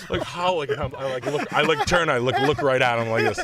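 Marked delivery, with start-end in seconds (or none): none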